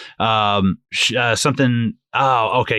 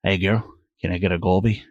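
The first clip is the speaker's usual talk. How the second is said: low, soft mock pickup line; back-seat come-on; 5 semitones lower, 5 dB quieter than usual. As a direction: murmur a joking proposition, low and quiet. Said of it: "and you're like".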